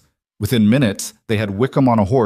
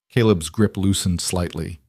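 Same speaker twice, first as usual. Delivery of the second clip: plain reading voice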